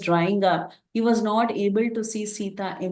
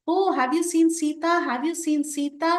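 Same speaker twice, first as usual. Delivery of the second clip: pitched-up, searching plea to the trees; anxious calling out; 7 semitones higher than usual. Acted. Rama asking the trees for Sita, pitched-up anxious plea. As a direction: call out for a lost wife, half-voiced. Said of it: "he was asking the flowers and the trees".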